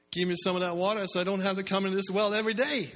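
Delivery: high, loud, rushed eager request; eager plea. Retold or imitated. Samaritan woman begging Jesus for the water, eager and loud. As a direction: blurt it out, thrilled at the offer.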